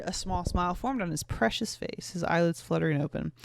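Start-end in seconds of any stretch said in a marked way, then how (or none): none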